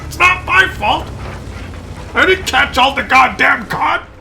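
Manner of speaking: high, loud, slow furious outburst of a politician who finally loses it; mad politician. Retold or imitated. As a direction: shout it out, slow and furious, as if losing control. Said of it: "he just got mad and he says".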